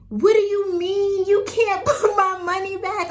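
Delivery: retold crying complaint; upset crying. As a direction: plead through tears.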